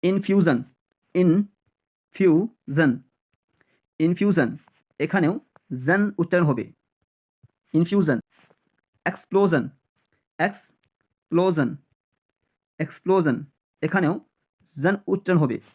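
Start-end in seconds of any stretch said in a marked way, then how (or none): 1.1-2.9 infusion slowly as in-fiu-zon; syllable by syllable. Shown as a demonstration. Teacher drilling learners, infusion in slow separate syllables. 10.4-11.7 explosion as eks, pause, plo-zon; split into parts. Shown as a demonstration. Teacher drilling learners, explosion split with a pause after eks. Teacher breaks word into pieces.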